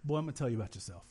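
a low, quick no-nonsense mama; stern mama. Retold or imitated. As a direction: rattle it off like a mama setting a teen straight. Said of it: "and she said".

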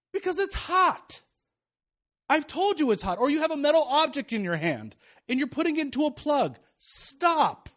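high-pitched, emphatic mock scolding; exasperated parent. Father warning daughter off danger, exasperated retold scolding. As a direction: act out a dad urgently warning a child.

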